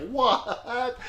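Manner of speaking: high, softish "what" of disbelief; stunned. Retold or imitated. Truck owner seeing a man step out, stunned. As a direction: imitate someone caught totally off guard.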